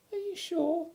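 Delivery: very high, soft worried squeak; tiny voice full of worry; anxious Piglet. Acted. Piglet anxiously doubting Pooh's song, high squeak. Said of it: "asked Piglet anxiously".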